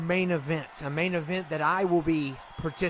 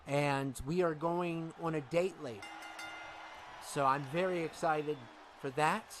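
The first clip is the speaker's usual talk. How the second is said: first brother, quietly pleased; 7 dB quieter than usual. Acted. Brother talks about his date.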